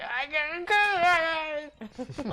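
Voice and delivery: high, slow, strained squeak; panicked squeaky voice. Acted. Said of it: "He's like".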